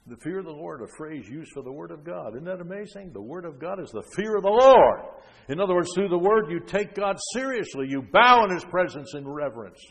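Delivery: high and loud, preaching at full power; fiery pulpit climax; full-force preaching. Acted. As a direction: build it up and hammer it to the congregation.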